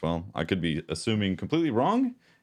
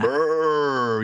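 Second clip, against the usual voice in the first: ogre noise; 8 semitones higher, 3 dB louder than usual. Pretend. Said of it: "you know, ogre go".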